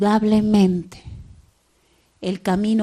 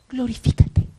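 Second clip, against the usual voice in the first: usual speaking voice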